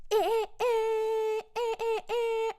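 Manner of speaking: high, slow honking noises; car horn imitation.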